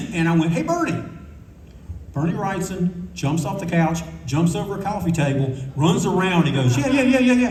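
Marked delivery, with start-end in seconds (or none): 0.5-1.0 high sing-out greeting; high, imitated call; retold hail. Hailing someone across the lobby. 6.8-7.5 high, rapid-fire mock eagerness; laughing, high-pitched imitated glee; giddy excitement. Overexcited fan running around acted out.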